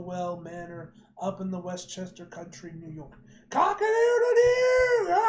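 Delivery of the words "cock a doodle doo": very high, loud, slow crowing shout; screaming rooster call. Acted. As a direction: crow at full volume like a rooster.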